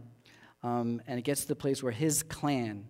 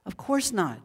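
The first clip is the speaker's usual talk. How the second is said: raised pitch, drawn-out exclamation; mild mock scream; 7 semitones higher, slower than usual. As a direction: cry it out without really shouting. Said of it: "he screams out".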